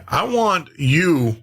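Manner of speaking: ordinary talk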